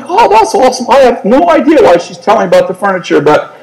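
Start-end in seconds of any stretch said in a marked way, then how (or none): 0.0-0.9 clueless eager reply. Retold or imitated.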